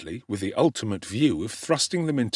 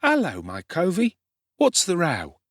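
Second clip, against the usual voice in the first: put-on higher, brash young urchin greeting; cocky street boy; 9 semitones higher than usual. A narrator's reading. Street boy hailing Oliver, cocky and brash. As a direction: swagger up to the stranger boy.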